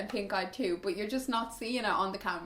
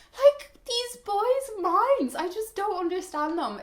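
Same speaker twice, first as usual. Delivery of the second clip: exasperated; 10 semitones higher, 4 dB louder than usual. Real, not acted. Exasperated at boys.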